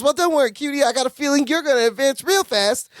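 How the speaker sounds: a touch of a sassy flirt teasing a new hire; flirty Fat Marcia. Retold or imitated. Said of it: "she goes".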